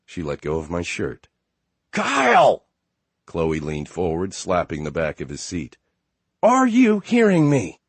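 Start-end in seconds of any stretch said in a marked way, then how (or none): 1.9-2.6 very high, loud scream of a name. Acted. Wife screams at driver. 6.4-7.8 very high, loud demanding yell. Acted. Wife yells at driver.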